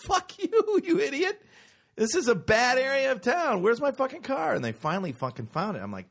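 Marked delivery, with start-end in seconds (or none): 0.1-4.5 high, loud furious yell at the valet; mock rage, pitched way up; hammed-up angry shouting.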